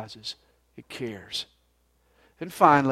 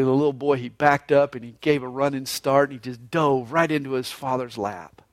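normal talking voice

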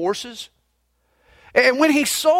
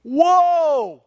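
panicked shout; 7 semitones higher than usual. Retold or imitated. Rider yelling at the runaway horse near the cliff, panicked shout. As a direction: yell to stop the horse at the cliff. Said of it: "he shouted".

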